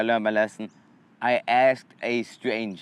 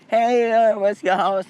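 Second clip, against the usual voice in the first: high and louder, chirpy; high cheery text voice; 8 semitones higher, 4 dB louder than usual. Retold or imitated. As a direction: call out the question brightly. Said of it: "text him, like".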